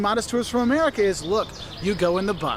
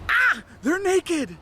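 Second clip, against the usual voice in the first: high gasping shock; mock shock; 10 semitones higher, much slower than usual. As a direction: gasp it out in shocked disbelief.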